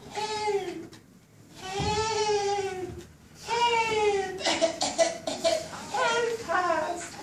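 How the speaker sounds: very high, squeaky little fish voice; tiny fish crying for help. Acted.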